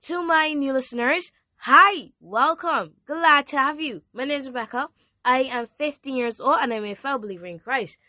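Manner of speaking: bubbly hello to newcomers; excited intro. Real, not acted. Teen host introducing herself to new listeners, excited.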